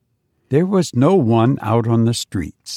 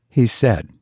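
usual speaking voice

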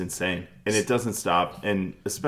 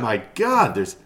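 ordinary delivery

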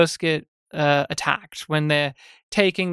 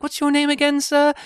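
dismissive colonial officer; 8 semitones higher than usual. Retold or imitated. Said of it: "They're like".

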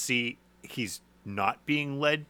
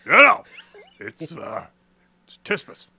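insistent sleepy voice; 3 semitones higher, 11 dB louder than usual. Retold or imitated. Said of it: "And I go".

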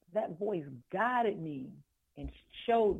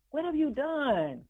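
high, gushing voice of amazed friends; excited admirers; 12 semitones higher than usual. Retold or imitated. People marvelling at her new glow, in a high excited voice. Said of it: "people would see me and say".

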